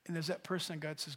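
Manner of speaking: ordinary delivery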